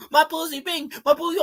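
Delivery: high-pitched teen girl imitation; mock teen girls. Streamer mocking teen girls hyped about a song, high mock girl voice. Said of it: "all the teen girls like".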